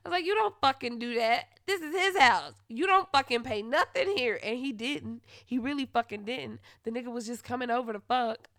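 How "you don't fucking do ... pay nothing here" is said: loud, sharp retold telling-off; angry scolding. Scolding boyfriend who doesn't pay rent, angry. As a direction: lay down the law, loud.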